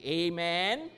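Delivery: ordinary delivery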